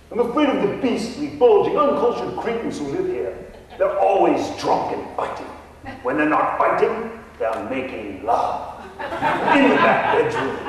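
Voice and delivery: hammed-up English gentleman ghost; mock English accent. Ghost Nigel answers the realtor in a fake English accent. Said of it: "a Texan trying to emulate an Englishman".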